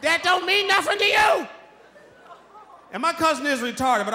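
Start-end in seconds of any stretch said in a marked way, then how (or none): 0.0-1.5 higher, slow, indignant grandma. Retold or imitated. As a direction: snap back like grandma. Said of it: "And I was like".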